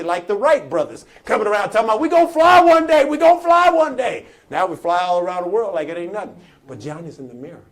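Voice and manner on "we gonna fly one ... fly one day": high, loud chant of a dreamer; excited proclamation. Retold or imitated. Wright brothers telling everyone their dream, high excited shout. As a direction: shout the wild dream out, imitating believers.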